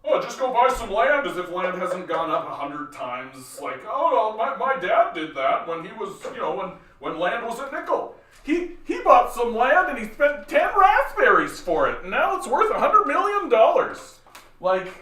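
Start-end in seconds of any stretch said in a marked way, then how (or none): 0.0-1.2 high-pitched mocking caricature; put-on voice ridiculing land-buying advice; mocked conservative. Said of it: "where they're like". 3.9-14.2 mocking falsetto, climbing as it goes; mocked conservative. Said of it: "It's what you sound like".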